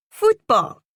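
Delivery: put-on high loud child's Football in Spanish accent; Football said by a small boy with a Spanish accent. A narrator's reading. Boy correcting his mother, high loud Football in a Spanish accent. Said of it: "he said in a Spanish accent".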